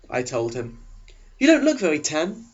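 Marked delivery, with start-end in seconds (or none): 1.4-2.4 eager Eric. Acted. Eric leaning over to the new girl, eager and high. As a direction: pitch him up high and keen, a chess-club type. Eric remarks on her skin.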